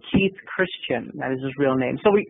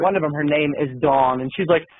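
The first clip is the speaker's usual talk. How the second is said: ordinary talk, nothing marked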